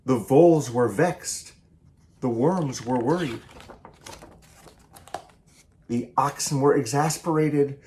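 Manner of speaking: lively picture-book read-aloud, each animal line acted; story reading. A narrator's reading. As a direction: read it to children with bounce and play. Author reads picture book aloud.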